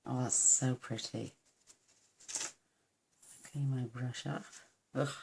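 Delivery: normal talking voice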